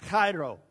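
chairo with a throaty phlegmy start; Greek pronunciation. Shown as a demonstration. Pastor demonstrates the Greek word chairo with throaty phlegm. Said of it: "You gotta get a little bit of that phlegm going on".

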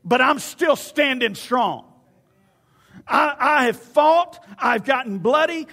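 loud, high preaching shout, words drawn out; defiant David. Acted. David answering Saul before Goliath, defiant shouted testimony. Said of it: "the way that he was saying it".

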